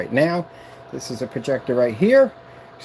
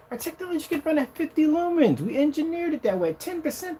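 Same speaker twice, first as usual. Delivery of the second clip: very high, put-on boaster's voice; high mocking impression of a braggart; mock boasting; 13 semitones higher than usual. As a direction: brag in a silly voice pitched way up. Mimics rival boasting about technology.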